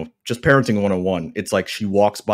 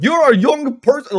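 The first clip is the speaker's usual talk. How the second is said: much higher and louder, mock Piper; 9 dB louder than usual. Piper scolding the young wrestler, high and loud. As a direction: snarl it like an old wrestler scolding the kid. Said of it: "he's like".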